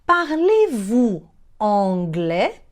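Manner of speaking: very slow, rising question; slow teaching demo. Shown as a demonstration. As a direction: stretch it out, lifting the end. Teacher models phrase slowly. (pergunta modelo, bem devagar)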